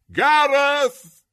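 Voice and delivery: piercing, drawn-out shrieked name. A narrator's reading. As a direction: scream for him, very loud and high. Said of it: "she shrieked".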